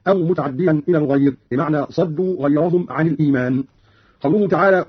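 ordinary delivery

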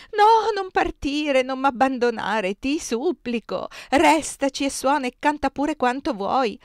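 high, loud wail, drawn out at first; desperate pleading. A narrator's reading. Superiora clinging to her departing friend, desperate pleading. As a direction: beg her not to go.

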